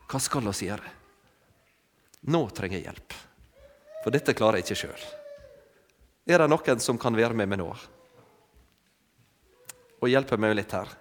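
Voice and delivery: a low, mock plea from the sinking sailors; calling for help. Preacher voicing sailors in the storm, asking children for help: low mock plea. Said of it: "begynner de å rope på hjelp".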